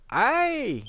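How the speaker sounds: high mock shriek; acted scream. Miss Ellie screaming in the night, acted shriek. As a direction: let out a high, held cry of alarm. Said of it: "An ear-piercing scream rings throughout".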